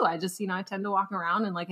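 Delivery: normal talking voice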